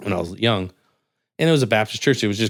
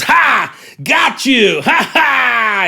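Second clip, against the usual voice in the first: much higher, louder gloating shout; triumphant gloat; 7 dB louder, much slower than usual. Retold or imitated. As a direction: crow over the win. Agent triumphs.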